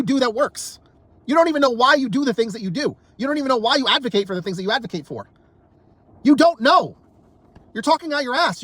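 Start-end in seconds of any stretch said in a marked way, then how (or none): 6.2-8.6 angry outburst. Real, not acted. Ranting at right-wingers, an angry raised-voice outburst. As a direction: slam the point home in anger.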